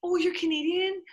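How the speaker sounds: mock-curious stranger, pitched high; eager stranger. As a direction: imitate an overeager tourist's question, high-pitched. Voicing people who meet Canadians.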